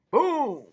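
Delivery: loud, high vocal crash; crashing boom. Retold or imitated. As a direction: boom it out like a big tree landing. Imitates the tree landing.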